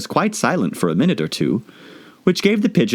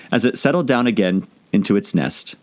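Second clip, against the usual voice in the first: ordinary delivery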